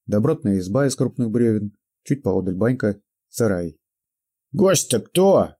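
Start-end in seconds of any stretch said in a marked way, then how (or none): 4.5-5.5 put-on high, slow toothless old man; old prospector mumbling. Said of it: "прошамкал старик".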